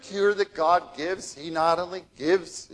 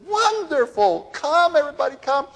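enthusiastic; 11 semitones higher, 4 dB louder than usual. Retold or imitated. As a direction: call everyone to the feast, pitched way up. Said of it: "he was enthusiastic".

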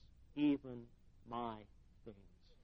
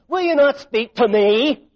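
demanding, raised and higher; angry Pilate; 7 semitones higher, 11 dB louder than usual. Retold or imitated. Preacher voicing angry Pilate demanding an answer. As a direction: Pilate pressing the silent prisoner. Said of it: "It made Pilate angry".

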